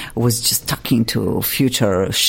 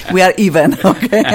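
laughing; faster than usual. Real, not acted. Host calls it quits with teasing guest, laughing. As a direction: laugh your way through the truce. Laughing after the mutual mix-up.

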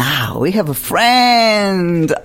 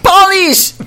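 loud, high-pitched cry; indignant correction; 7 dB louder than usual. Real, not acted. Host corrects guest who called her accent Russian, loud indignant outburst.

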